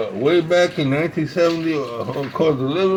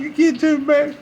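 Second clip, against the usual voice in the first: high-pitched mock protest; retold objection, pitched way up; incredulous retort; 10 semitones higher than usual. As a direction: exclaim it as if that's absurd. Retold retort to worried friend.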